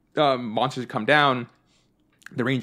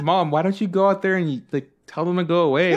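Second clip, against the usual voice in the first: higher whiny plea; kids pleading; 6 semitones higher, 3 dB louder than usual. Retold or imitated. Kids urging mom to chase women off, high whine. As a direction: beg mom like a little kid. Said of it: "me and my brother like".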